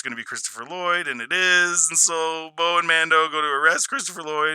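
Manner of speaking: ordinary talk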